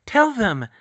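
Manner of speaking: high, loud, firm command; insistent. A narrator's reading. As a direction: press the order home. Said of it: "she insisted".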